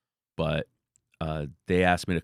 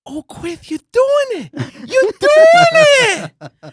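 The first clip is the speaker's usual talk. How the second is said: very high, slow, shouting with delight; hammed-up overjoyed high shout; excited Tyson impression; 13 dB louder, much slower than usual. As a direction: squeal it out like a thrilled champ.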